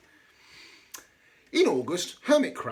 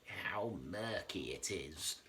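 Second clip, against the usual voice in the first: lower, much softer and slow, a mild grumble; understated complaint from the coral; complaining; 7 semitones lower, 12 dB quieter, slower than usual. A narrator's reading. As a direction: grumble it slowly into the murk. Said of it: "complained the coral".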